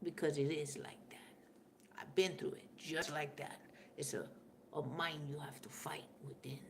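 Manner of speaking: hushed confiding whisper. Real, not acted. Admitting to viewers the inner fight, a real whisper. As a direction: let it slip out in a whisper. Confiding about own struggle.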